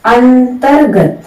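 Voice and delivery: अंतर्गत drawn out, r jerked into ग; careful slow अंतर्गत; slow model word. Shown as a demonstration. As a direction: say अंतर्गत slowly, an-tar-gat. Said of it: "इसी प्रकार एक और है".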